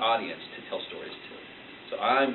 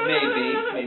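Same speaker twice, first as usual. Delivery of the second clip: playful; 5 dB louder than usual. Real, not acted. Playful answer to slippers.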